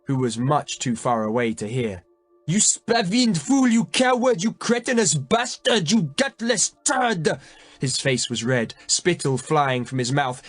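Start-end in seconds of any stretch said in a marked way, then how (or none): 2.4-7.3 king cursing his cowardly brother, screaming; screaming insults. A narrator's reading. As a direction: spit each insult out in fury. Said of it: "the king screamed at his brother".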